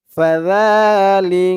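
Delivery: "ফাযালি" slowly, with "যা" held long; syllable-joining demo. Shown as a demonstration. Teacher joining spelled letters, drawn-out "ফাযালি". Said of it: "খারা জবর এক আলিফ টান".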